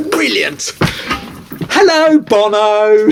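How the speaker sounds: high, loud mocking hello; sarcastic hello to a supposed prank caller; sarcastic greeting. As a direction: sneer a fake welcome at the prankster. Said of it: "I go".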